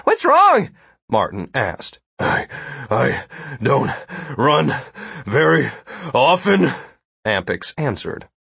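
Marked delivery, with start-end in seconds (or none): 0.0-0.7 high, loud urgent question; calling out. A narrator's reading. Martin checks on the winded guard, calling out. 2.2-6.7 gasping between every word; winded. A narrator's reading. Big guard explains himself after running, winded. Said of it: "the big guard was already winded".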